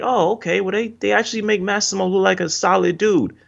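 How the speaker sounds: high-pitched, impressed realization; retold pleasant surprise. Viewer reacting to a likable rival character, pleasantly surprised. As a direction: react as if won over, pitch well up.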